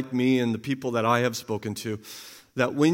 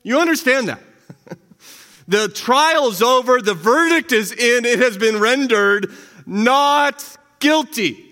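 higher, louder, proclaiming the verdict; triumphant preaching; 8 semitones higher, 5 dB louder than usual. Real, not acted. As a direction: ring out the good news.

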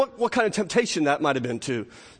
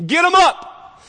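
high, loud heckle from the pew; imitated shout; 12 semitones higher, 8 dB louder, slower than usual. Elderly woman interrupting the preacher's sermon, imitated shout. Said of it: "and then she shouts".

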